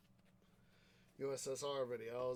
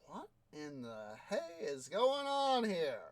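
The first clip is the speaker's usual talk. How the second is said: baffled exclamation; 5 semitones higher, 7 dB louder than usual. Real, not acted. Confused at odd game events.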